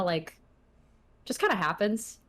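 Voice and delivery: ordinary talk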